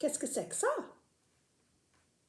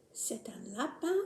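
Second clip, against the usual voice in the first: whispering; 5 semitones lower, 7 dB quieter than usual. Acted. Whispered answer.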